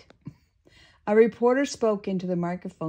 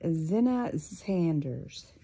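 usual speaking voice

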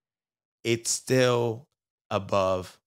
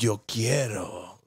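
put-on Spanish accent; 3 semitones lower, 5 dB quieter, slower than usual. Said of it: "What is that voice?".

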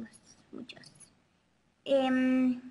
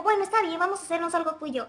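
higher-pitched mother giving in; lightly voiced mother; 4 semitones higher, faster than usual. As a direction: imitate mom agreeing, voice lifted. Voices her mother agreeing. (mamá imitada, voz más aguda)

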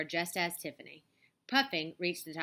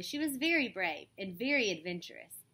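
plain reading voice

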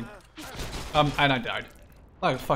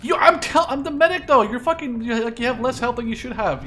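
high, loud exasperated telling-off; exasperated scolding; 8 semitones higher, 5 dB louder than usual. Real, not acted. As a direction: scold him, you're the medic. Medic scolding teammate.

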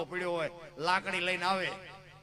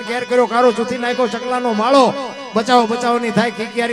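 loud, high, driving poem recital; folk verse recitation; 7 semitones higher, 20 dB louder than usual. Acted. Reciting a friend's earthquake poem to the crowd, loud declamation. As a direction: declaim it in long runs, raised and forceful. ઈ આ ધરતીકંપની વાત બાપુ એને લખી છે.

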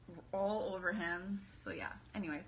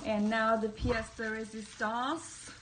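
slow, grand mock-French "pièce de résistance"; hammed-up French accent for the big reveal; weird French accent; 4 dB louder, slower than usual. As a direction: announce it like a French maître d'. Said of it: "saying that with, like, a weird accent".

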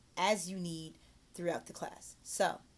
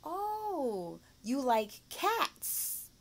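slow, higher echo with the final S sounded in CATS; gentle correction; 6 semitones higher, much slower than usual. Shown as a demonstration. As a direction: gently model CATS with a clear ending. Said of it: "Then correct them gently".

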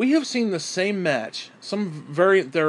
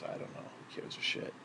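whispered aside; 19 dB quieter than usual. Real, not acted. Despairing aside to himself.

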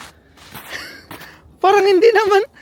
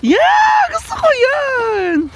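excited shout; 11 semitones higher, 6 dB louder, much slower than usual. Real, not acted. Thrilled at sledding.

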